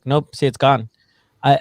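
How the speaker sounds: usual speaking voice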